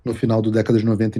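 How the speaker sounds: ordinary talk, nothing marked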